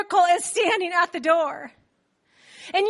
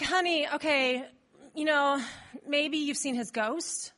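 slow, lower, softer coaxing; patronising patience; 4 semitones lower, 6 dB quieter, slower than usual. Acted. Believers offer Rhoda another explanation, patiently patronising. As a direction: humour the child carefully. Elders humour Rhoda with ghost theory.

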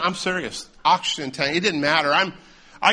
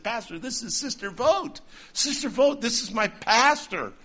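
eager introduction; 7 semitones higher than usual. Retold or imitated. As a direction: re-enact a chirpy introduction, pitched high. Acting out introducing pastor and old lady.

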